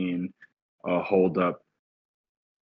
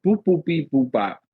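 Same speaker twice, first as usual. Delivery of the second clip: robot beeps; 9 semitones higher, 6 dB louder than usual. Retold or imitated. Robot computing in his head.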